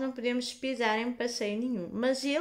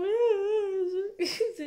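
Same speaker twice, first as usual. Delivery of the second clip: higher crying laugh; laughing through tears; 11 semitones higher, 5 dB louder than usual. Retold or imitated. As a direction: laugh and sob at once. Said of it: "a chorar toda".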